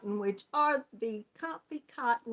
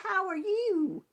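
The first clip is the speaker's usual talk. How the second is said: normal talking voice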